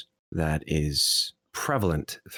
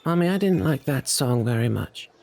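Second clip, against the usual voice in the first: put-on higher-pitched child voice; little boy; 5 semitones higher than usual. Little boy tells his mother about the song, child's voice. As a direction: complain like a small boy. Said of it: "The little boy looks up at his mother and says".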